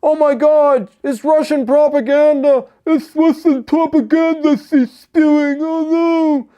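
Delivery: high, loud, mocking falsetto whine; squealing mock panic about propaganda; mocking whiny NAFO voice. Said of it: "those idiots, are immediately jumping to".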